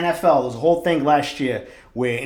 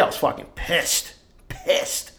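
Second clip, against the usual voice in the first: slow, whispered, seething through the words; whispered fury; 3 semitones higher, slower than usual. Real, not acted. Telling listeners how mad the unlocked door made him, slow whisper. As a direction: hiss it out under your breath, slowly.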